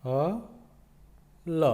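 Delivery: ह and ल said apart with a pause between; teacher spelling aloud; sounding out letters. Shown as a demonstration. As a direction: split हल into its letters. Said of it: "दोनों को मिलाकर बनेगा".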